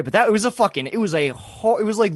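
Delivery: normal talking voice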